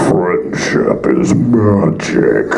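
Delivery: deep, very slow monster voice saying a cute line; giant centaur voice. Retold or imitated. Giant centaur Hecarim drops a pony catchphrase, very slow and deep. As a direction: boom it out slowly like a huge centaur. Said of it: "and he goes".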